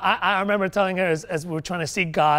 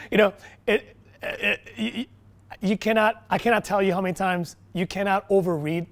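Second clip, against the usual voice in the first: usual speaking voice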